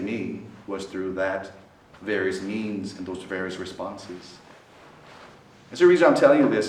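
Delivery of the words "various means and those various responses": usual speaking voice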